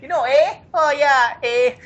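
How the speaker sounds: put-on high-pitched caricature of a Canadian accent; badly faked Canadian with exaggerated "eh". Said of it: "That's not really how they sound".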